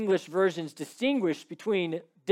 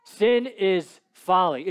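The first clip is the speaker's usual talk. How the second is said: ordinary talk, nothing marked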